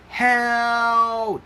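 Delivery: high, loud desperate cry; cry for help. Acted. Impala fleeing the leopard, crying out loud. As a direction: yell for help as you flee. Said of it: "Chucky cried".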